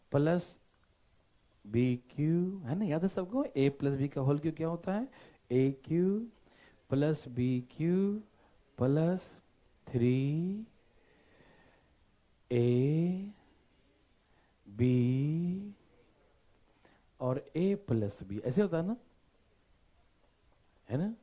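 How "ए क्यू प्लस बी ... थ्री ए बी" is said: word by word, long pauses, rising ends; formula dictation. Real, not acted. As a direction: recite it piece by piece for copying. (रुक-रुककर सूत्र बोलते हुए)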